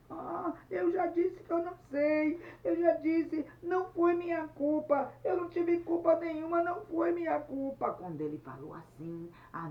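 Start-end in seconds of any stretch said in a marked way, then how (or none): 0.1-7.9 high, loud wailing protest; tearful crying voice. Acted. Luiz denies blame to Anete, crying loudly. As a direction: protest innocence in an acted sob. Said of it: "E Luiz, chorando, disse".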